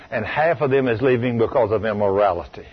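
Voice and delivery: usual speaking voice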